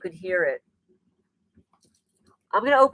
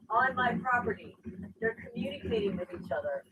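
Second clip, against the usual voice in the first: dropping to a near whisper, much softer; hushed; 3 semitones higher, 11 dB quieter than usual. Real, not acted. Streamer hushing to viewers about the witches outside, soft whisper. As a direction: keep it hushed so the witches outside don't hear.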